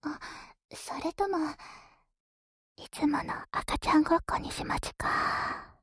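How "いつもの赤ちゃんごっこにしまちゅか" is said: put-on whispered cooing mommy baby talk. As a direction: coo it like talking to a baby.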